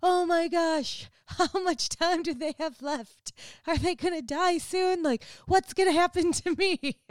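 put-on a worried child gasping about her parents' age; childhood panic. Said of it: "I remember thinking".

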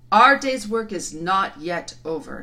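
slow loud lion voice; commanding lion. Acted. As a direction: command the crowd. Aslan commands.

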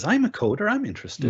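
high-pitched mock eagerness; imagined keen listener. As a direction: pipe up like an interested volunteer.

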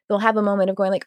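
ordinary talk

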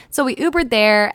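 ordinary delivery

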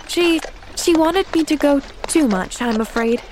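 high, halting, rueful admission; sighing. A narrator's reading. Girl explains her aunt's reaction to the doctor, sighing. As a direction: admit it reluctantly, stumbling at the start. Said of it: "sighed Pollyanna".

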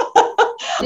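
voiceless high giggle; airy giggle. Real, not acted. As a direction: giggle in delight. Host laughs at admission.

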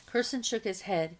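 ordinary delivery, nothing marked

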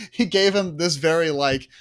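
ordinary delivery

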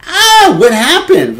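high, loud yelp of alarm; acted panic, voice leaping up; shocked scream. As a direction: cry out in horror. Shock at seeing blood.

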